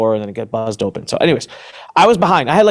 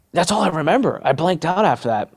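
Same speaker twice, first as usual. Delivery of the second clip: normal talking voice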